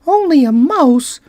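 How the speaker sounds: high, offended little mouse squeak; a tiny mouse, insulted; indignant squeaky mouse. Acted. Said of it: "cried the little animal indignantly".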